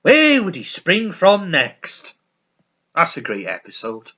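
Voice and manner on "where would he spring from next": loud slow mock cry of the name; hammed-up action hero voice; mock shout. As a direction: call out the name slowly and loudly. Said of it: "Bruce Lee, he goes".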